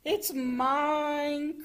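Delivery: high, drawn-out call. A narrator's reading. Froggy calls for the ball, high calling voice. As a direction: sing out a claim for the catch. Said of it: "called Froggy".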